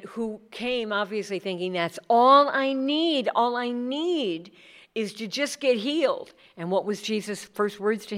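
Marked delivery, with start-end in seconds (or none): 1.7-6.3 slow, pleading, higher and louder; voicing a hopeful man, drawn out; hopeful sick man. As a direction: lean on each word like it's all you want. Man hoping only for healing.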